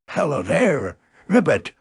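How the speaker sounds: lightly voiced bouncy tiger; Tigger. Tiger greets his friend on a bouncing day, lightly voiced character. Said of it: "said Tigger".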